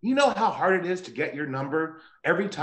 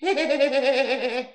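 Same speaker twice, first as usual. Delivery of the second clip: high, quick rippling cackle; high doll giggle; 7 semitones higher than usual. Acted. Killer doll jokes with his victim, high cackle. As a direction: giggle in a high staccato run. Chucky laughs mid-apology.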